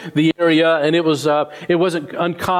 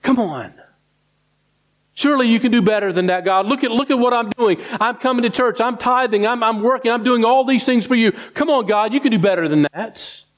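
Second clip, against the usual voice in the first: raised, pushy protest to God, a touch mocking; higher mock gripe of "come on, God"; indignant complaining; 6 semitones higher than usual. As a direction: play the churchgoer who feels owed. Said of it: "And then we're like".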